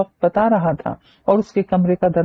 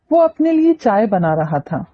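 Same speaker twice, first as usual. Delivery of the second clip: ordinary delivery, nothing marked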